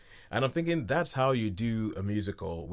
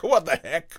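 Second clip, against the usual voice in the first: mock disgust; 6 semitones higher, 5 dB louder, slower than usual. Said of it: "the look on his face of disgust".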